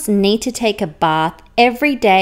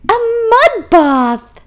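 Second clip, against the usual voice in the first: high, louder stress on mud; delighted exclaim; 12 semitones higher, 7 dB louder than usual. Acted. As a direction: announce it with glee. Unicorn delighted.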